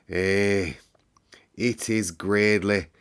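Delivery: light Yorkshire lad's voice; lightly voiced Yorkshire boy in wonder. Dickon marvels at the garden, light Yorkshire. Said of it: "he said".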